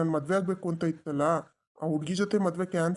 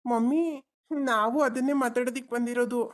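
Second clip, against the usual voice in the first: put-on higher, childlike voice for the son; little boy; 7 semitones higher than usual. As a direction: play the small boy tugging at his dad. Adult voicing the child. (ಪುಟ್ಟ ಹುಡುಗನ ಧ್ವನಿ)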